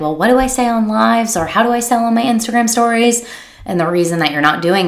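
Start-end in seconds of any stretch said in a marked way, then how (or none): 0.0-3.2 mock lost client. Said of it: "you're like".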